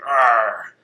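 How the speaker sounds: loud gruff Scottish dwarf; Scottish accent. Shown as a demonstration. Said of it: "a nice, like, Scottish accent".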